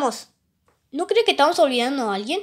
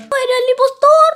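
much higher and louder shout; 13 semitones higher, 10 dB louder than usual. Pretend. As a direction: yell the line out. Repeating the vote result. (gritado mucho más agudo y fuerte)

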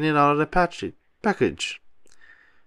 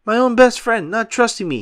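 higher, louder hurt yell; upset yell; 10 semitones higher, 5 dB louder than usual. A narrator's reading. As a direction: cry out at the betrayal. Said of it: "he yelled".

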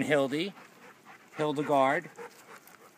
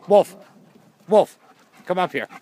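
high, loud dog commands; calling out; 5 semitones higher, 11 dB louder than usual. Real, not acted. Owner calling Wolf to come up here, loudly. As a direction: call the dog up sharply.

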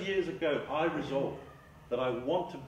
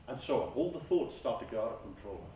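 ordinary delivery

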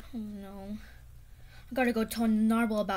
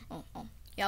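ordinary talk, nothing marked

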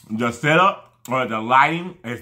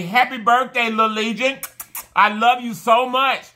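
high, gushing birthday shout-out; excited birthday wish; 8 semitones higher than usual. Real, not acted. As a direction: celebrate them with a raised, delighted pitch. Birthday shout-out to a young fan.

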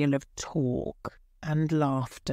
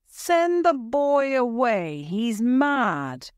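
angry crowd; 7 semitones higher than usual. A narrator's reading. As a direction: jeer the boy away. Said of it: "they said".

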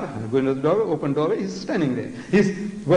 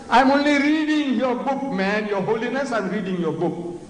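mock shout; 7 semitones higher than usual. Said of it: "So he shouts".

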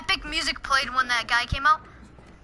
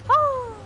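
high, drawn-out "woo"; excited whoop; 9 semitones higher than usual. Real, not acted. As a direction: whoop with glee on the run. Cheering while racing in game.

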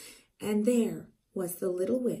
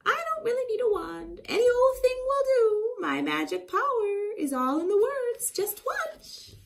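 high, louder boastful witch; 11 semitones higher, 4 dB louder than usual. Acted. Zildy bragging to the dog about her magic, high. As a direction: show off your magic proudly. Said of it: "Zildy speaking".

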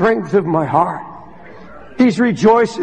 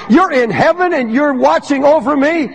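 higher, ringing pulpit voice peaking on God; triumphant sermon peak on God's strength; preaching at full force; 5 semitones higher than usual. Real, not acted. Preacher climbs to climax.

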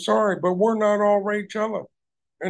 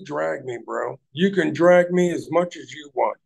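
ordinary talk

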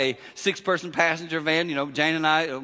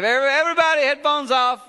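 much higher and louder call; calling out to everyone; 10 semitones higher, 6 dB louder than usual. Real, not acted. Dad calling the whole van to take headphones off, loud. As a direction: get everyone's attention at once.

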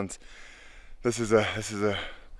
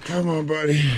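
effort grunt; 3 semitones higher than usual. Real, not acted. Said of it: "sorry for all the grunting noises".

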